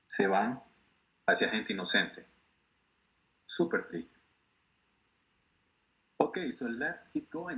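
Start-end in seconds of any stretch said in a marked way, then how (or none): 3.5-4.2 Súper triste whispered, breathless and sad; a hushed, sad aside; whispered sadness. Real, not acted. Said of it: "sad remark whispered".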